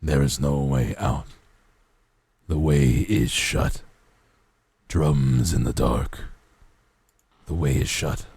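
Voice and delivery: deep ancient undead king voice; the Lich. Retold or imitated. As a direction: drop low like a rasping lich. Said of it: "this sounds like, uh, the Lich from Adventure Time".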